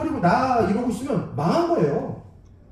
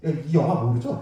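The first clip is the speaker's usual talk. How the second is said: ordinary talk, nothing marked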